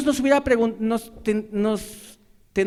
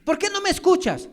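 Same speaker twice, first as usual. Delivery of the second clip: much higher, accusing question; demanding reproach; 10 semitones higher, 3 dB louder than usual. Retold or imitated. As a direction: fling the question at them. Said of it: "demanda algo que no hace".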